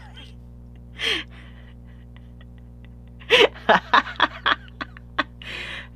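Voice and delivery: high breathy giggle; giggling fit. Real, not acted. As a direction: burst out in unvoiced giggles. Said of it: "I'm just gonna leave that in 'cause it's funny".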